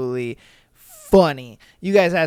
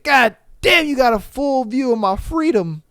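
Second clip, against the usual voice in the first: mock amazed guy; 6 semitones higher, 3 dB louder than usual. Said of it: "He said".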